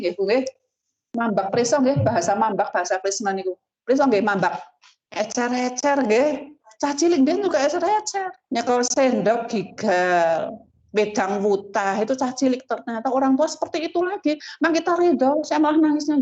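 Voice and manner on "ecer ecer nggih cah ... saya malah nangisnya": high, heartfelt sermon voice; emotional preaching. Acted. Preacher telling listeners about caring for old parents, high and moved. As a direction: speak of ageing parents with feeling.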